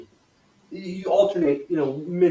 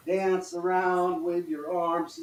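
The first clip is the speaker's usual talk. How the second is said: usual speaking voice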